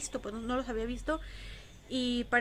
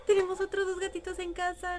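higher, thrilled announcement; excited; 8 semitones higher, 3 dB louder than usual. Real, not acted. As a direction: burst out with the happy news. Announcing surprise kittens.

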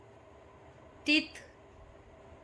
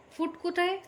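ordinary talk, nothing marked

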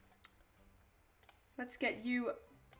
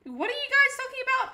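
much higher, louder bewildered question; baffled surprise; 11 semitones higher, 6 dB louder than usual. Real, not acted. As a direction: react like chat lost you. Confused by chat.

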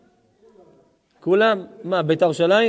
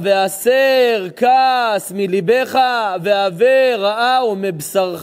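slow solemn verse; 6 dB louder, much slower than usual. Acted. Quoting scripture, chanted slowly.

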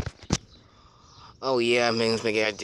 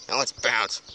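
ordinary talk, nothing marked

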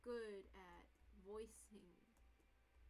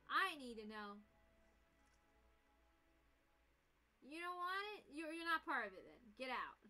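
angry outburst; 3 semitones higher, 7 dB louder than usual. Real, not acted. Gamer raging at the game.

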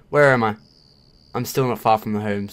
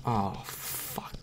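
ordinary talk

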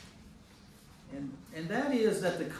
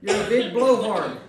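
loud, higher teasing rebuke of Job; mock scolding; 4 semitones higher, 10 dB louder than usual. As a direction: scold him like an exasperated friend. Voicing the friends scolding Job.

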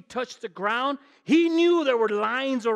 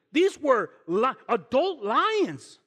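adult lions, slow and drawn out, louder; slow emphasis; 3 semitones higher, 5 dB louder, much slower than usual. Real, not acted. Preacher drawing out lions' size for church, slow and loud. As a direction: drag out adult lions for weight. Stressing danger.

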